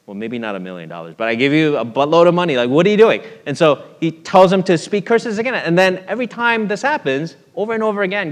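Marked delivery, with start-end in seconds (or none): 1.3-3.2 louder, mildly exasperated retold complaint; frustrated king. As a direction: act out a king's mock exasperation. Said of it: "Balak gets frustrated and says".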